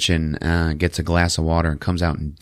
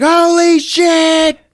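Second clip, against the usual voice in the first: very high, loud, drawn-out shriek; hammed-up group astonishment; shocked yell; 8 dB louder, much slower than usual. Everybody reacts to moving glass.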